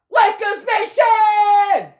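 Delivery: long, stretched, high shout of Lakers Nation; drawn-out hype shout. Real, not acted. Streamer hyping up Lakers fans in chat, drawn-out high shout. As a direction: belt it out slowly like an arena announcer.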